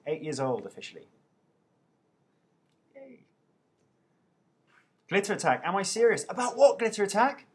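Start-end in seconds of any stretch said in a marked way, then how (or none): none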